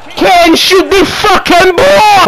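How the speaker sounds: high, slow, furious yell; angry shout. Real, not acted. Gamer yelling at Kane to shoot, angry. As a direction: drag it out in frustration.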